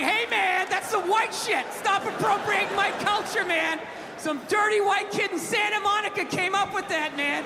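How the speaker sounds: high, loud mock outrage; mock offended. Comedian imitating someone offended over skateboarding, loudly indignant. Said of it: "Do I get all offended like".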